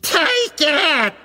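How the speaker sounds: the woman shouting back at the voice. A narrator's reading. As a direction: yell it back just as loud. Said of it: "she shouted".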